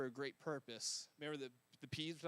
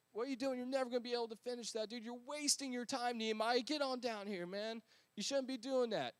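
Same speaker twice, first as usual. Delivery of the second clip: higher jeering taunt; mocking enemies; 6 semitones higher than usual. Enemies scoff at Nehemiah from below, higher jeering voice. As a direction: scoff at the wasted effort.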